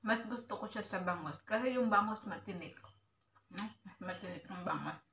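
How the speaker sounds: talking while chewing; words muffled by food; mouth full of food. Real, not acted. Seen 0: cheeks bulge, chewing while talking, fingers in bowl of food.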